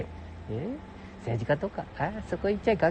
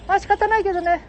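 high, louder resigned put-on voice; falsetto second voice; 6 dB louder than usual. Companion conceding about shop holidays, high falsetto. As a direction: shrug it off in falsetto.

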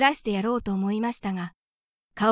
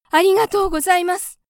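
higher and louder second thanks; more fervent thanks; 9 semitones higher, 8 dB louder than usual. A narrator's reading. Mother mouse thanking Gauche again, louder and higher. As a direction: press the thanks harder.

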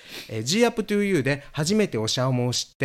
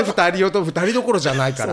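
ordinary delivery

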